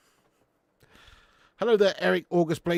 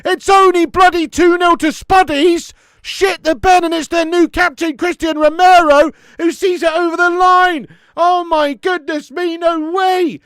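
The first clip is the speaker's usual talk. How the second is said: screaming with joy, much higher and much louder; ecstatic goal shout; 17 dB louder, slower than usual. Real, not acted. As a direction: roar the goal like you can't believe it. Celebrating a goal live.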